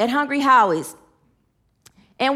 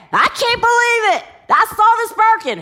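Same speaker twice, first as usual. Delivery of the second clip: higher, louder, alarmed blurt; shocked driver; 8 semitones higher, 5 dB louder than usual. Retold or imitated. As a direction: burst in rattled by the sparks. Driver rushes in, panicked.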